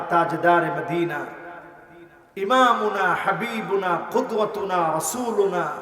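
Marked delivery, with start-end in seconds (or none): none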